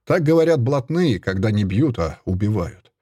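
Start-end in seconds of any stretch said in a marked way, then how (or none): none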